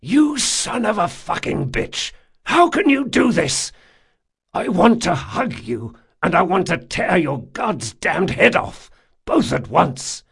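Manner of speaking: love and rage at once; torn furious shout. Acted. Jean torn between hugging and killing Locke, ragged shout.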